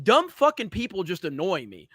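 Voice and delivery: ordinary talk, nothing marked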